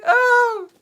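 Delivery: very high crying shriek. Retold or imitated. Still in the [name] fan meme voice, shrieking for [name]. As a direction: keep the tearful fan wail going. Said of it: "It's like [name]!".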